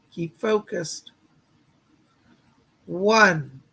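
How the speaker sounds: calm, steady visualization guide; walk them gently into the light; guided meditation. Acted. Guiding the viewers to picture a spark of light, soothing meditation voice.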